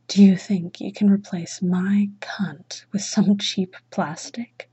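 usual speaking voice

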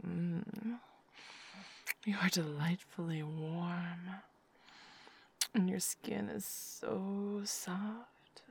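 hushed afterglow murmur, mostly whispered; intimate whisper. Acted. Lover settling her partner to sleep, hushed whisper.

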